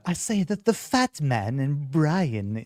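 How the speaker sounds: put-on weird, pompous cartoon voice; Stewie Griffin. Shown as a demonstration. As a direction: draw it out like a haughty cartoon baby. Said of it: "have to have this sort of".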